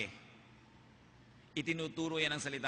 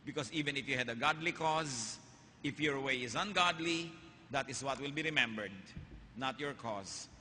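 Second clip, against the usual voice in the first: ordinary talk, nothing marked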